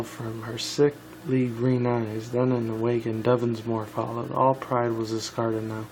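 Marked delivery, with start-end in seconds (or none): none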